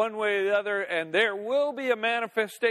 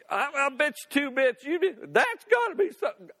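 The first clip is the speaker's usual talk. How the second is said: startled boater; 7 semitones higher than usual. Pretend. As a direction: pitch jumping higher, excited and rattled. Imagining a scared boater.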